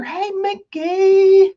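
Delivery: high, drawn-out greeting call; long, high-pitched hello. Real, not acted. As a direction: stretch the name out high to hail a friend. Calling out to an arriving viewer.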